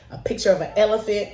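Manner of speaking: ordinary talk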